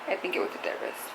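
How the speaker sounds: quick, breathless whisper tucked in as an afterthought; whispered aside. Real, not acted. Recalling her mom's therapist visit, she whispers the correction. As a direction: drop to a hushed whisper, almost to herself.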